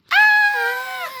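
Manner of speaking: mock scream. Fright at the shape.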